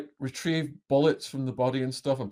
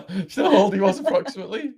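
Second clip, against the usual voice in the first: real laughter through the question; quick, high, laughing question; 7 semitones higher, 6 dB louder, faster than usual. Real, not acted. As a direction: ask it while laughing, higher and louder. Interviewer laughs while repeating his question.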